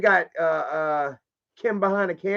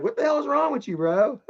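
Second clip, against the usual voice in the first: imitating annoyed friend; 9 semitones higher than usual. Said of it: "He's gonna be like".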